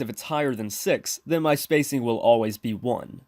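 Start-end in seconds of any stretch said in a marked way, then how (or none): none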